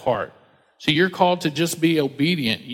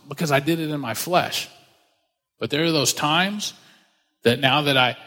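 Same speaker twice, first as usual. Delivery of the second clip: ordinary talk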